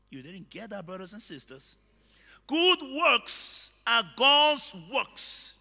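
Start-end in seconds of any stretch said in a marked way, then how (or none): 2.5-5.6 emphatic, building sermon refrain; ringing pulpit declaration; full-force preaching. Real, not acted. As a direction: preach it out, hammering each parallel phrase.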